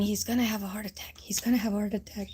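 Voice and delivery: urgent fast whisper; hurried warning under the breath; retold whisper. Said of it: "kept kicking my side and saying".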